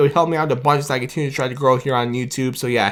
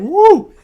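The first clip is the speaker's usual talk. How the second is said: high, loud celebratory woo; excited whoop; 5 dB louder than usual. Real, not acted. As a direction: whoop for the team. Celebrating a big signing.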